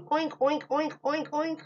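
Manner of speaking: high, light pig oinks; playful oinking. Acted. Person in a bird suit playing pig, high playful oinks. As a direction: oink like a silly piglet. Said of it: "somebody in a bird suit saying".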